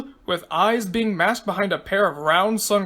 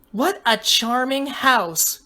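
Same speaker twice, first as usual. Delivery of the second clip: put-on higher, slow, delighted girl's voice; smiling; 4 semitones higher, slower than usual. A narrator's reading. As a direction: admire it slowly in a light high voice. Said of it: "she smiled".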